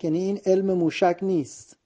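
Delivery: ordinary talk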